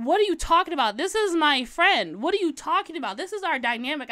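loud, indignant defending; heated defense of her friend. Retold or imitated. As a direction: snap back in indignant disbelief. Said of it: "I was highly heated".

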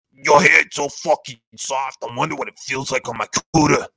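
a hint of a creepy inmate, quick on the end; lightly voiced leering cellmate; mock creepy whisper-close admirer voice. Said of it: "Tracy will be standing there like".

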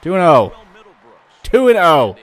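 loud, high cheer calling the score; excited shout. Real, not acted. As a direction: yell the score out in triumph. Celebrating the score.

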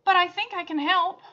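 put-on old woman offering help, bright; brightly. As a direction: offer help with eager cheer. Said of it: "she said brightly".

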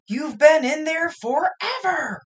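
scolding mom. Acted. Mom scolding through bathroom door.

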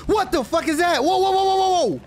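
high, loud startled whoas; startled yelp. Real, not acted. Gamer spooked by something on screen, high startled yelps. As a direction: jump at the scare and cry out.

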